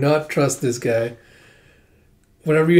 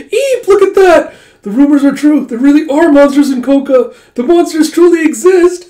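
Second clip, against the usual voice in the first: frightened squeal; 13 semitones higher, 11 dB louder than usual. Acted. Soldiers spotting the dragon hand, terrified high yelp. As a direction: shriek at the monsters you feared.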